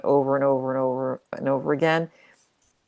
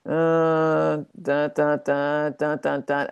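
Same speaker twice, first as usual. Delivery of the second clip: monotone droning on one note; lifeless repetitive drone; slower than usual. Shown as a demonstration. Coach mocks affirmations without vitality, droning monotone. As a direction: plod through each syllable with no energy. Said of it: "as opposed to".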